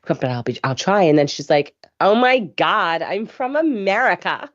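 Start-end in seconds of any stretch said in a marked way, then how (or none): 2.0-4.5 drawn-out, exaggerated American accent; hammed-up, slow American accent; mock American accent. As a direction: imitate an English girl attempting an American accent. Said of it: "Do you ever do an American accent".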